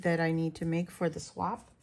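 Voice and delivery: normal talking voice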